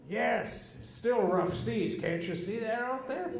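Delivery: mock obstinate dad; stubborn insistence. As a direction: dig in stubbornly. Dad insists on rough seas.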